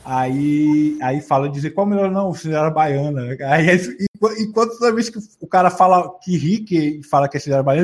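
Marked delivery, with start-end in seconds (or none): none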